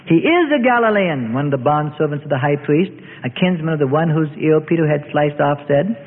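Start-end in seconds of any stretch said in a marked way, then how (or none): 0.1-1.3 raised-pitch accusation; accusing. A narrator's reading. Bondservant exposing Peter, accusing. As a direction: point him out, pitch lifted. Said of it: "decided to press their suspicions".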